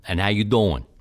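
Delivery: mash up Baltimore and Philly; Philly-Baltimore mix. Shown as a demonstration. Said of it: "I got a mix-up of Baltimore and Philadelphia".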